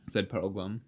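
plain reading voice

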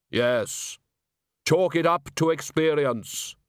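slow, dry Death voice; bass voice. Acted. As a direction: speak as unhurried Death. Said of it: "you do have a very good bass voice".

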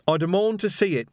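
high-pitched, zealous insistence; fervent demand. A narrator's reading. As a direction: demand it like an earnest revolutionary. Fervent revolutionary demands his file.

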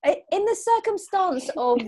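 pompous hypothetical caller; polite caller. Retold or imitated. Friend mocking her call to the fire brigade, prim.

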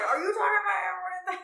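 high, loud shouted question; hammed-up screaming friend; mock scream. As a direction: yell it at the door in disbelief. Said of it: "the first thing he's screaming".

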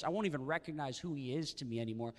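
ordinary delivery

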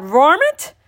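shouted high and loud; bawled call. Acted. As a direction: bawl it across the road. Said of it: "bawled out".

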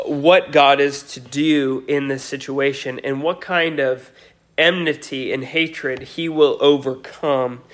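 normal talking voice

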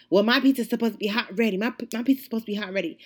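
fast, high indignant griping; hurried, heated complaint, retold. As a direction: mimic a customer's rapid protest. Acted quarrel, customer complains.

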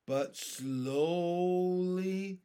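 very slow, stretched SLOWLY; lingering, sustained narration; drawn-out slowly. A narrator's reading. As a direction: draw out the word slowly.

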